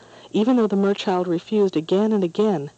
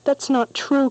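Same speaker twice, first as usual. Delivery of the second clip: higher, louder protest; crying out; 8 semitones higher, 3 dB louder, slower than usual. A narrator's reading. Merchild protesting the boy's insults, crying out higher. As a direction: cry out in hurt denial. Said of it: "cried the merchild".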